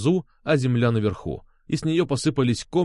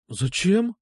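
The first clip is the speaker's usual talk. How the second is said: higher, bewildered woman's question; puzzled; 6 semitones higher than usual. A narrator's reading. Алёна questions Сергей's request, puzzled. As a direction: ask in puzzlement, pitched up. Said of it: "спросила она недоумённым голосом".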